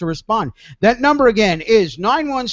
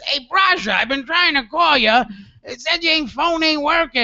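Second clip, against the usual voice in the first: high-pitched impression of an older caller; imitating Joe; 8 semitones higher than usual. Host retelling his friend's cell phone call, high-pitched imitation. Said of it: "he called me on my cell phone and says".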